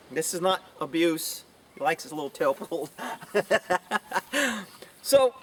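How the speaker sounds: ordinary delivery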